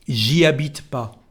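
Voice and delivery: "J'y habite pas" with "J'y" as one syllable; spoken French "J'y habite pas", silent H and no ne; dropped ne. Shown as a demonstration. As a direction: contract the start to "zhee" in "J'y habite pas". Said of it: "We don't pronounce it".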